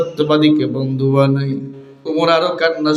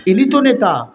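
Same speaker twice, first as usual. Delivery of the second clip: higher-pitched, falling exclamation; raised prompt; 6 semitones higher than usual. Real, not acted. Preacher prompting the crowd, raised exclamation. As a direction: put it to the crowd, pitch lifted.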